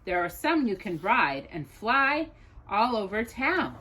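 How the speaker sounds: ordinary delivery, nothing marked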